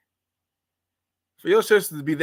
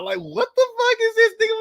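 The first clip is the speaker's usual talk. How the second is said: much higher, incredulous outburst; exasperated high cry. Real, not acted. Host venting about modern labels, high incredulous cry. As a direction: throw your hands up vocally.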